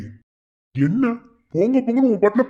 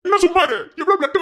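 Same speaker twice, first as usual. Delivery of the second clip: angry retort; 9 semitones higher than usual. Acted. Student snapping at friend telling him to hush, angry. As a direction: fire it back angrily.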